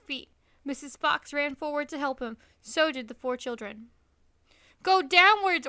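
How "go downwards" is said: high, loud command; order. A narrator's reading. Mister Fox ordering his family to dig down, loud command. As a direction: bark the order. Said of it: "ordered Mister Fox".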